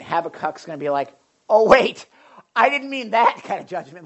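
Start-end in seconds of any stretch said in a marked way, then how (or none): 1.5-4.1 loud, higher-pitched mock protest; alarmed Habakkuk. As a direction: burst out in hammed-up alarm. Voices Habakkuk's alarmed backpedalling.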